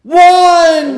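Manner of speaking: high, loud cry of the dice result; excited shout. Real, not acted. Cohost calling out the dice roll, a loud high shout. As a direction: yell out the winning number.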